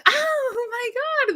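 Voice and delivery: very high, long drawn-out wail of shock; stunned cry. Retold or imitated. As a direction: wail it out in disbelief, very high and slow. Her shocked reaction at work.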